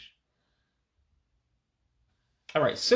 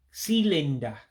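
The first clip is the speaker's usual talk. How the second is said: cylinder as silinda in an Igbo accent, pitched up; 3 semitones higher than usual. Shown as a demonstration. Teacher says cylinder with an Igbo accent. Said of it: "say it with sort of an accent".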